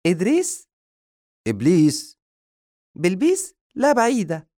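put-on high, loud ditzy girl voice; silly girl voice. Madiha answering the man about the jinn, high ditzy voice. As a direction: mishear it with cheerful confusion.

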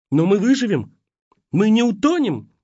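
high, loud frightened questions; terrified. A narrator's reading. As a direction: beg for reassurance in fear.

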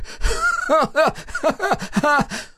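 very high wild cackle; maniacal laugh. Acted. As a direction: laugh madly. Captive laughs before dying.

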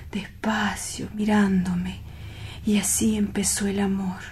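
hushed and far away in the past; dreamy whisper. Acted. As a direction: whisper it like a treasured memory. Lovesick man recalls her kiss.

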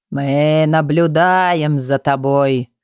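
slow creaking monster voice; creaky monster. Acted. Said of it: "проскрипел безглазый монстр".

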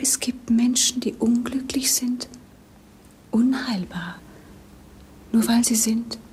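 sad, breathy whisper; whispered lament. Acted. Princess grieving to her governess, in a whisper. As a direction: whisper it as if afraid to be heard.

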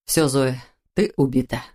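panting after a sword fight; winded, breathing hard. Acted. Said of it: "тяжело дыша". (тяжело дыша после поединка)

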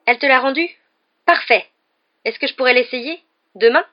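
high, loud, eager questions; excited. A narrator's reading. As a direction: burst out thrilled to your friend. Friend thrilled about the returned broom. (enthousiaste, aigu et fort)

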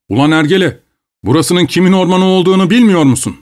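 furious yell. Acted. Overseer yelling at Semyon after the whip, high and furious. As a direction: lash out with the words.

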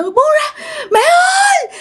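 very high-pitched screams for help; terrified screaming. Acted. Girl screaming for family to rescue her, shrieking in terror. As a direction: scream desperately for mother and grandmother. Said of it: "Bà dì Tư la hét lên".

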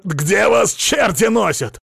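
loud, high, drawn-out angry shout; red-faced man laying into someone; angry outburst. A narrator's reading. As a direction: lash out at them at full volume. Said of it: "накинулся на него краснолицый".

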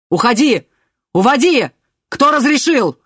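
loud angry yell; shouting. A narrator's reading. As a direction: shout the order furiously. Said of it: "кричал человек в шинели".

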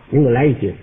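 low-pitched customer placing an order; polite customer. Acted. Customer buying fruit from a surly clerk, low and polite.

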